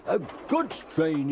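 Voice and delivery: building indignant grumble; grumbling. A narrator's reading. Gordon grumbling about pulling dirty trucks, building grumble. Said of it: "he grumbled".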